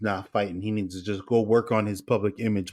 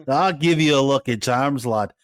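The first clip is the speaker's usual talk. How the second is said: mock Irish slipping into Scottish; hammed-up Scottish-tinged brogue; half-hearted Scottish burr; Scottish-ish accent; 2 semitones higher, 5 dB louder than usual. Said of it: "That's a little bit more Scottish".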